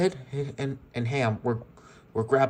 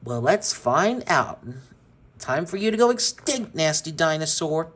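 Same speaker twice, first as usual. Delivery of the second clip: louder, higher taunting; cruel retort; 4 semitones higher, 4 dB louder than usual. Acted. Sid retorting to Rex before burning him, cruel. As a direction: sneer it at the dinosaur. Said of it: "Sid retorted to Rex".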